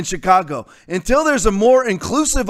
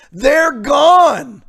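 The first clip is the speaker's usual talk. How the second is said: loud, raised "They're gone"; shout; 5 semitones higher, 5 dB louder than usual. Real, not acted. Host ranting about sports fans leaving, shouting. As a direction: slam it out in anger.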